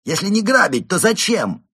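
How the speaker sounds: loud, high-pitched challenge; exclaimed demand. A narrator's reading. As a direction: cry it out at the suspect. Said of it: "вскричал инспектор". (громкий требовательный вскрик)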